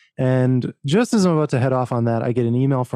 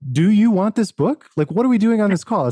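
pressing challenge; 7 semitones higher, 3 dB louder than usual. Retold or imitated. Retells pressing the editor.

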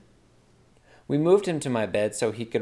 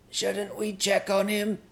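high, slow, strained voice; a pinched, high maid voice; maid's voice; 10 semitones higher, slower than usual. Acted. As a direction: speak up high while being choked. Maid speaks from the chokehold.

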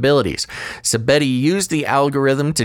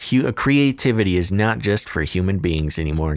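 ordinary talk, nothing marked

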